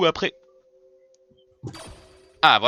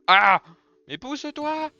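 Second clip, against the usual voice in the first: startled yell; 8 semitones higher, 8 dB louder, slower than usual. Real, not acted. Blocked by monster in doorway.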